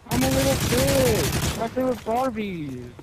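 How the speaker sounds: whiny crybaby impression. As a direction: squeal it like a spoiled brat throwing a tantrum. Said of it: "I whine and bitch and complain".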